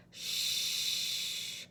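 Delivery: soft unvoiced shush. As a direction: hush them gently. Hushing at bedtime.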